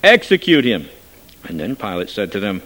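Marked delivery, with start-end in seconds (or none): 0.0-0.9 loud shouted demand; crowd cries out. A narrator's reading. Crowd shouting to the governor for execution, loud cry. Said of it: "they cried out again".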